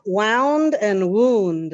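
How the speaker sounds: "wound" first as wownd then woond, slowly; "wound" wownd then woond, slow and clear; slow contrast of "wound" wownd and woond; two pronunciations. Shown as a demonstration. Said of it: "pronunciation is totally different".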